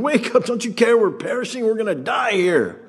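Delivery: high, frantic cry for help; panicked shout. Acted. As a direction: yell to wake him in terror. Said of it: "And they're going".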